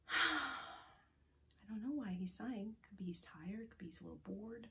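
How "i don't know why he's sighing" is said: slow, breathy whispered line after a sigh. Shown as a demonstration. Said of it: "Sighing is when you go".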